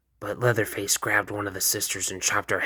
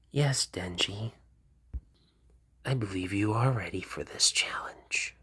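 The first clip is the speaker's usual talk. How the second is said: hushed whisper; 6 dB quieter than usual. Acted. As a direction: lean in and whisper it. Makima whispers all her lines.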